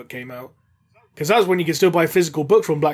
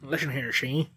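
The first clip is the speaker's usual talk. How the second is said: put-on old-time gangster; 3 semitones lower, 9 dB quieter than usual. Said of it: "Every other word coming from the Ratling".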